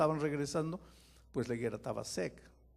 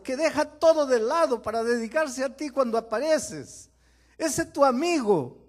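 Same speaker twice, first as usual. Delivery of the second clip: rising into a loud high plea, building; a pleading messenger growing louder and higher; urgent pleading; 9 semitones higher, 8 dB louder than usual. Acted. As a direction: beg harder with every name. Messengers begging Jesus for Lázaro.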